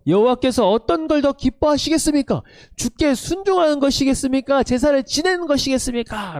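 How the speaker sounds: high, indignant prophet's reproach; mock angry rebuke. Prophet Samuel rebuking King Saul, indignant and high. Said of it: "그러면서 뭐라고 말하고 있냐면".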